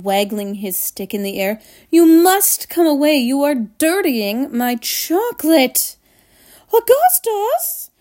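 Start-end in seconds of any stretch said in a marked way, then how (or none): 1.9-6.0 urgent, raised protest; distressed, emphatic order; crying out. A narrator's reading. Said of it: "cried Mister Wonka". 6.7-7.9 high, drawn-out wail of a call; mother calling shrilly; crying out. A narrator's reading. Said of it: "cried Missus Gloop".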